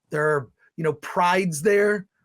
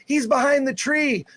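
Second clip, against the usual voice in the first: mocking daughters; 6 semitones higher than usual. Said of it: "the daughters will say".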